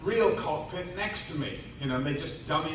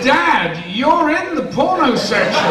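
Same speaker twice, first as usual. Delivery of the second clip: high, loud imitation of an accusing child; shouted mock outrage, pitch way up; son's outraged retort; 10 semitones higher, 11 dB louder, slower than usual. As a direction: call Dad out at the top of your voice. Son's retold retort to dad.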